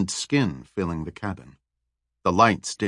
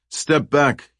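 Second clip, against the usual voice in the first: higher-pitched, mildly commanding order; 5 semitones higher than usual. A narrator's reading. Guard orders prisoners back from the door, mildly commanding. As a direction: give a short, understated order. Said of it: "he ordered".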